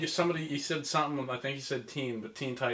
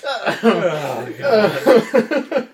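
laughing; 6 semitones higher, 8 dB louder than usual. Real, not acted. Still laughing.

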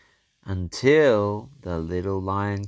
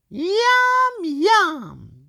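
very high squeaky yum yum; kitten voice; 7 dB louder than usual. Acted. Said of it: "said the little cat".

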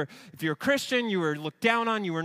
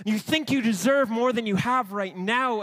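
ordinary delivery

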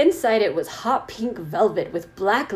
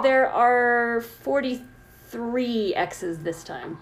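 drawn-out slow reading; slow it right down; much slower than usual. Acted. Reading slowly.

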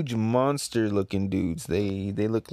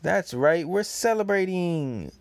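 excited announcement; 6 semitones higher than usual. Acted. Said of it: "it says".

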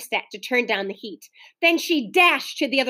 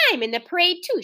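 lightly voiced girl; 7 semitones higher, faster than usual. A narrator's reading. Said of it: "she said".

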